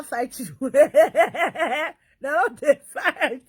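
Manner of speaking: slow drawn-out laughing words; laughing slowly. Real, not acted. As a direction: stretch it out mid-laugh.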